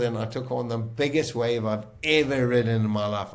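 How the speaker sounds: normal talking voice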